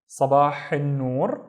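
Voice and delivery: Sabah al noor slowly, each word drawn out; slow model phrase. Shown as a demonstration. Teacher giving the morning reply, Sabah al noor slowly. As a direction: stretch Sabah al noor for learners.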